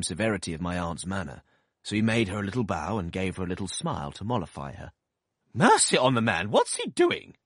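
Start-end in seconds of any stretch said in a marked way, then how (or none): 5.5-7.4 loud, high exasperated outburst; impatient cry. A narrator's reading. Aunt crying out impatiently at the bowing doctor. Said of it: "cried my aunt impatiently".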